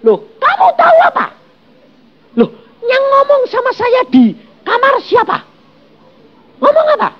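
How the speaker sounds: high falsetto woman's voice; high-pitched excited woman. Acted. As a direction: squeak it out like the running wife. Woman argues with her husband.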